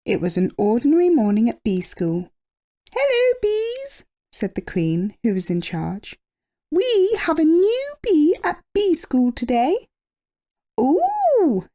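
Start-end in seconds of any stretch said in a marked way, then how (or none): 2.9-4.0 high, bright-toned regal greeting; queen voice. A narrator's reading. Queen welcoming the bees to school, high and regal. 6.7-9.9 high regal announcement; queen voice. A narrator's reading. Queen telling bees about the new pupil, high and regal. 10.8-11.7 very high ooh of wonder; little bees voice. A narrator's reading. Little bees marvelling at the new bee, high and wondering. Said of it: "said the little bees".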